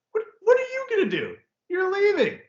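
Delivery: high, loud, fast scoffing; mock outrage. Player mocking the coach's threat, high scoffing outrage. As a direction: scoff at a boss who's already leaving.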